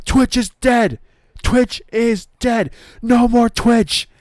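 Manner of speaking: high, loud, slow mock-furious decree; livid. Brody Lee bans Twitch in front of Uno, livid. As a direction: hammer each word like a raging boss, hammed up. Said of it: "he's livid".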